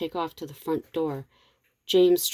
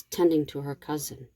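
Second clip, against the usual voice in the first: ordinary delivery, nothing marked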